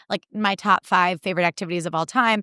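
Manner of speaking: normal talking voice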